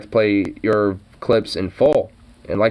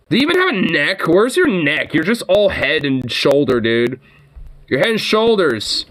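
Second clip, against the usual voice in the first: taunting ridicule; 6 semitones higher than usual. Acted. Mocking insults at the anchor.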